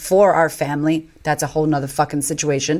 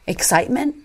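tentative answer; 6 semitones higher than usual. Retold or imitated.

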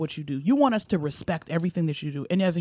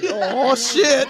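mock alarm; 7 semitones higher, 6 dB louder than usual. Echoing the cake drop.

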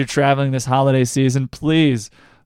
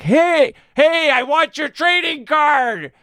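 much higher, slow put-on silly voice; goofy mock request; 12 semitones higher, slower than usual. Traveler asks the info desk for a card, hammed-up silly voice. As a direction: play a dopey traveler at the airport desk.

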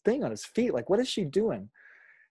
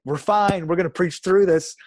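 preaching through it; 6 semitones higher, 10 dB louder than usual. Retold or imitated. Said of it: "and I'd be like".

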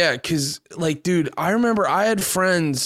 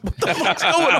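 high, laughing bafflement; incredulous giggle through the question; laughing disbelief; 7 semitones higher than usual. Retold or imitated. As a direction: laugh it off in confusion. Baffled by friends' hostility, retold.

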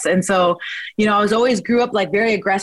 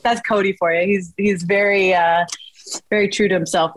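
usual speaking voice

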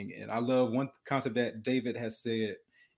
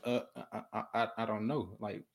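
soft halting stutter; imitating a stuttering boy; 4 dB quieter than usual. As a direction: stumble over the words like a nervous kid. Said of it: "he still has a stuttering problem".

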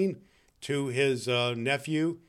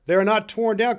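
high, loud exclamation; crying out; 7 semitones higher, 7 dB louder than usual. Acted. Scrooge finds his bed still whole, crying out. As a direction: cry it out emphatically. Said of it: "cried Scrooge".

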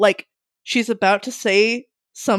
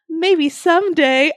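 slow, high coy princess voice; 8 semitones higher, slower than usual. Retold or imitated.

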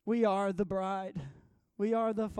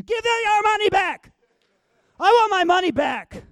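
much higher, louder furious yell of money back; angry shout; 12 semitones higher, 13 dB louder than usual. Retold or imitated. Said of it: "I was so mad".

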